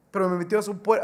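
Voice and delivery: normal talking voice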